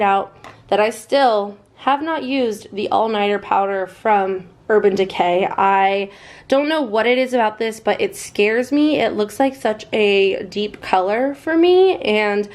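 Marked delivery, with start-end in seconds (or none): none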